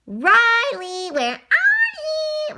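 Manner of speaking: high, loud searching call. Acted. Little sister looks for her brother, drawn-out call. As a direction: call for someone who is out of sight.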